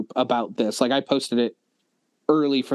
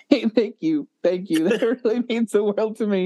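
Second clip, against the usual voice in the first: laughing thanks; 7 semitones higher than usual. Real, not acted. Moved friend thanking his podcast host, laughing. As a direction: let a laugh break through the gratitude.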